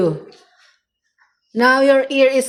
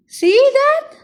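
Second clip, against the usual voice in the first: high indignant exclaim; 13 semitones higher than usual. Real, not acted. Owner showing her bitten hand to the pet, high and indignant. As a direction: show the bite with a high outraged yelp.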